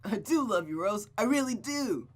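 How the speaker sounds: loud, high joyful declaration, climbing; elated hooting. Acted. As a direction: whoop it like a lovestruck boy. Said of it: "He hooted and did a little dance".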